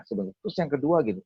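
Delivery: ordinary delivery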